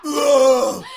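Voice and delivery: loud, high mock grunt of pushing; hammed-up shouted heave; straining groan. Acting out squeezing him out of the body, straining groan.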